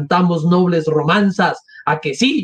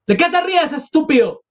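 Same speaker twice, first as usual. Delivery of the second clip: indignant snap; 11 semitones higher than usual. Acted. Mother snapping at an onlooker.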